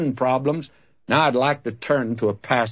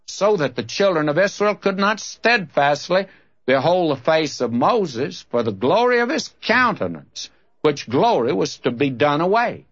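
scripture reading; 4 semitones higher than usual. Acted. Reads scripture aloud.

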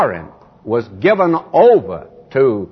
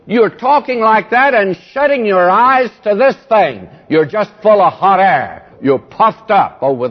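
raised, higher preaching voice; fervent rebuke; 6 semitones higher than usual. Acted. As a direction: scold the congregation from the pulpit.

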